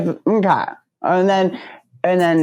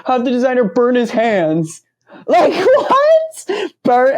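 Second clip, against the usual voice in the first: laughing disbelief; 6 semitones higher, slower than usual. Real, not acted. Laughing at absurd excuse.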